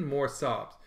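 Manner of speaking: ordinary delivery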